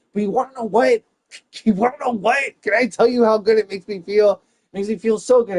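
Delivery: high, loud gleeful gush. Real, not acted. Comic gleefully gushing to the audience about mom leaving.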